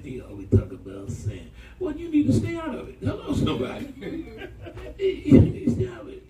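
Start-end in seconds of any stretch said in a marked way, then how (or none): 4.0-4.9 soft high laugh; real laugh mid-sermon. Real, not acted. Laughs after "Hello, somebody. 5.0-6.2 laughing, higher and louder; mock warning said through real laughter. Real, not acted. Laughing while warning congregation.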